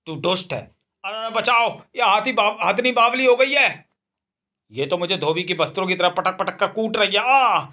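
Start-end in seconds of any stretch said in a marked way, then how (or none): 1.0-3.9 high, frantic yelps for rescue; panicked cry for help. Acted. Man being thrashed by the elephant, panicked high cries for help. 7.2-7.6 pained cry. Acted. Thrown down by the elephant.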